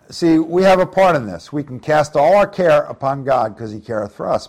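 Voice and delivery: usual speaking voice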